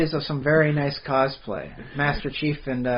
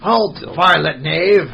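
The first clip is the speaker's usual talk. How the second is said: put-on slow, louder, pompous old-English challenge; mock medieval knight; 3 semitones higher, 6 dB louder, slower than usual. Guard halting a sword thief, slow mock medieval bluster. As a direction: declaim it like a ren-faire guard. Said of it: "slip into that medieval".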